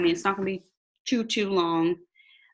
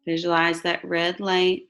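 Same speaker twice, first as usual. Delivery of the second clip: slow, calm meditation voice; guided meditation; slower than usual. Acted. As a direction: guide them gently into relaxation.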